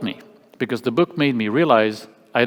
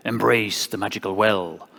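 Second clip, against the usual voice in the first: mystical professor; 3 semitones lower than usual. Retold or imitated. Imitating an art school professor, lightly mystical. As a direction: intone it like a cryptic sage, lightly put on. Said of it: "I had a professor who'd say".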